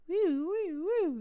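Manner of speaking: playful woo. Shown as a demonstration. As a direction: let it flow with high woos. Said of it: "that flow".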